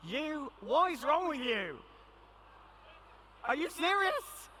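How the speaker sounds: playful are-you-serious; mock disbelief. Real, not acted. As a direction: tease them as if shocked. Teasing a volunteer in disbelief.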